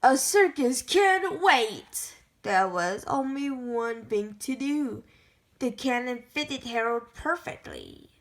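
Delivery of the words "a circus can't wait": ordinary delivery, nothing marked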